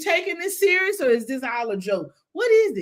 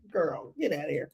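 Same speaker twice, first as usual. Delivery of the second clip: normal talking voice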